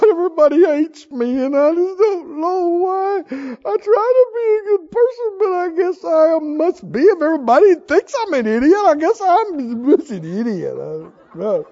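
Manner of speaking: high, loud hammed-up whimpering; mock sobbing self-pity. Hiding in bed, mock crying over being called an idiot. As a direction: ham up a crying sulk under the covers. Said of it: "pile up in the bed and cover your head and go".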